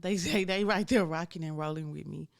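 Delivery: loud, laughing through the words; a happy loud laugh inside the words. Real, not acted. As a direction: laugh it out with the line. Laughs mid-line, louder.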